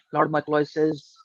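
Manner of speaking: usual speaking voice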